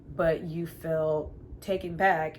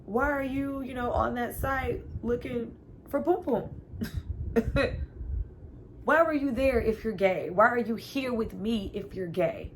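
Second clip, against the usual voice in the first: mock confused woman; 7 semitones higher, 3 dB louder than usual. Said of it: "she's like".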